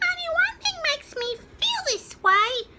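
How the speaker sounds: high, loud chick voice; peeping chick. A narrator's reading. Smallest chicken feels cosy, high peep. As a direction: chirp it like a tiny chick. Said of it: "peeped the smallest chicken".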